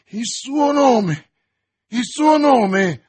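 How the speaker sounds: loud, high yell with long drawn words; urgent yell. A narrator's reading. D'Artagnan demanding the woman's name, urgent yell. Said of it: "urlò D'Artagnan".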